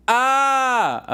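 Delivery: high, loud scream of agony; Chris Rock. Acted. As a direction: hold one long pained scream, hammed-up. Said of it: "this is Chris Rock".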